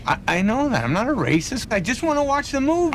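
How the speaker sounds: defensive. Acted. Passenger protesting, rising pitch.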